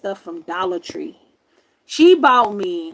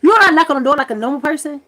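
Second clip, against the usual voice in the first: irritated retort; 5 semitones higher, 5 dB louder, faster than usual. Retold or imitated. Retold scolding of uncle.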